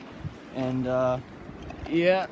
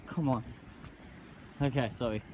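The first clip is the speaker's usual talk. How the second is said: usual speaking voice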